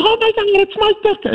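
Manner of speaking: very high squealing fan voice; starstruck fan lady. Retold or imitated. Older lady flipping out at meeting the coach. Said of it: "She goes".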